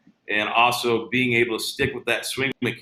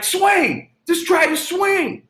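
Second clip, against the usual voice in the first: very high, louder SoCal coach voice; imitating Ken Ravizza; 4 dB louder, slower than usual. Coach riding on the player's back urging a swing, very high SoCal voice. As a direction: urge him on in a squeaky-high coach voice. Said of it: "really high, SoCal voice".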